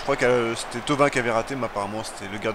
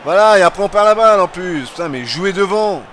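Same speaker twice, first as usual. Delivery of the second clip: loud, exasperated yelling; frustrated shouting; 6 semitones higher, 9 dB louder than usual. Real, not acted. Yelling at players to play forward.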